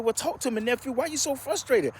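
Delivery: a caring older uncle, put on a bit high; voicing uncle.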